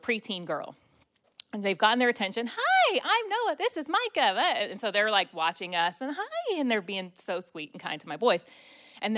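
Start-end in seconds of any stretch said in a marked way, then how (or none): 2.5-4.4 put-on high, slow, sweet little kid greeting; little boy voice. Little son greets strangers. 6.2-6.6 sweet greeting. Retold or imitated. Strangers greet the boys.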